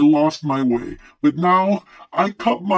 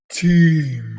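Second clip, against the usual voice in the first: Team said firm and louder, falling; word repeated firmly; 5 dB louder than usual. Shown as a demonstration. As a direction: plant the word Team like a correction. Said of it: "the word team".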